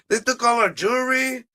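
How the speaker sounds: normal talking voice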